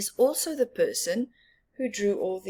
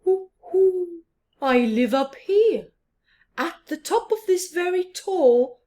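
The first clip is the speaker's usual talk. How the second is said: higher, louder hooting owl; 7 semitones higher, 6 dB louder than usual. Acted. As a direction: hoot it proudly from up high. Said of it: "the owl said".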